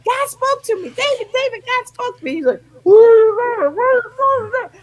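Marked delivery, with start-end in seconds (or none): 0.1-2.3 very high-pitched, breathless-with-news insistence; urgent, excited, pitched way up; excited announcing. Retold or imitated. Excited news to waking husband. 2.8-4.7 loud, high, slurred waking cry; muddled, alarmed husband just woken; startled husband imitation.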